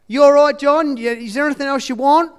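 high, loud sing-song nurse voice; cheery nurse. Retold or imitated. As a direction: brightly check on the old patient. Voicing nurse asking patient.

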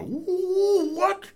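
very high ooh what; shocked ooh. Retold or imitated. Reacting to the triple lock, high surprise. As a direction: squeal of disbelief. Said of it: "And I was like".

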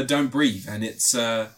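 usual speaking voice

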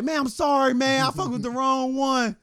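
high whiny mock apology; thief apologizing. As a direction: beg like you messed with the wrong one. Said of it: "He's like".

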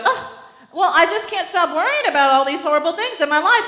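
high, loud, fast fretting woman; anxious woman. Retold or imitated. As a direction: gush out her worries in a rush. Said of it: "she goes".

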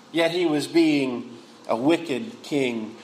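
usual speaking voice

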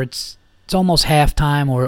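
ordinary delivery

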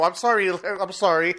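normal talking voice

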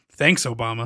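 dry, resigned "Thanks, Obama"; deadpan Obama quote. Retold or imitated. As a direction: deliver it deadpan like the meme. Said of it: "And Obama says".

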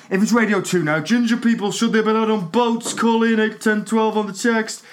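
mock radio presenter voice. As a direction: sell it like a breakfast DJ.

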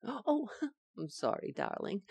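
soft, laughing sorry; giggling apology. Acted. Wife apologizing in bed.

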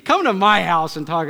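put-on high, loud incredulous teen; mock teenager scoffing at the preacher. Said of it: "you're like".

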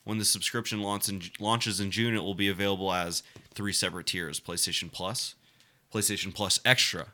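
reading the article aloud in a steady report voice; news article reading. Acted. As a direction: read it like a news piece.

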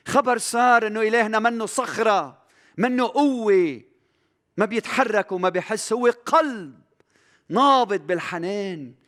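high, loud, mounting sermon delivery; fervent preaching. Acted. Preacher to his brothers on God's compassion, fervent and loud. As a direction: preach it hard, building each line.

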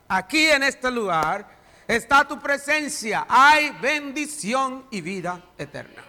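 prayer swelling to loud preaching; fervent prayer. Acted. Pastor praying aloud over the congregation, fervent prayer. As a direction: pray with growing fervour.

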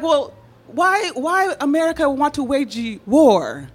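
put-on puzzled Brazilian-accented English; imitated Brazilian accent. Imitating Brazilian friends' English questions.

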